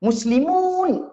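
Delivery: stretched teacher model of muslimun; model pronunciation. Shown as a demonstration. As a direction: sing out muslimun for the class. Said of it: "Kalau kita sebut".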